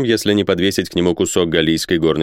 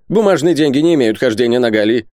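higher, insistent merchant's objection; protesting; 7 semitones higher, 3 dB louder than usual. A narrator's reading. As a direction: lay down the objection in a raised pitch. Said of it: "запротестовал Исаак Хакабут".

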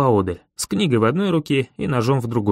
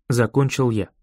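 plain reading voice

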